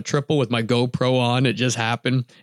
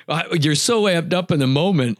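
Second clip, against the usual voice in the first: ordinary talk, nothing marked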